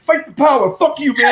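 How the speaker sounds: high, loud laughing defiance; defiant crowd. Retold or imitated. Bar crowd defying the director, loud and laughing. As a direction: shout it back like a rowdy crowd. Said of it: "it's kinda like".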